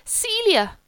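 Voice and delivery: high, gushing hostess greeting; excited greeting. Acted. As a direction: call out in society delight.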